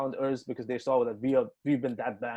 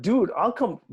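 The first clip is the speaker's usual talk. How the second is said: high, loud, eager burst; retold eager outburst; 8 semitones higher, 5 dB louder, slower than usual. Musician jumps at the promoter's offer, high and eager. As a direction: jump at the offer, voice shooting up.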